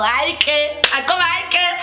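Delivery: high-pitched, hammed-up despair; mock panic attack. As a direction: wail it high as if all is lost. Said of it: "have a, a panic attack".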